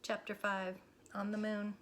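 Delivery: usual speaking voice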